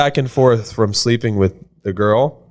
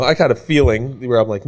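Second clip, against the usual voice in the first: ordinary delivery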